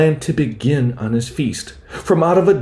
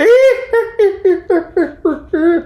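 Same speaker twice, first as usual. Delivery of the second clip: high shrieking witch cackle; witch's cackle. A narrator's reading. The witch laughs aloud as her hat nearly blows off, high cackle. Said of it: "The witch laughed aloud".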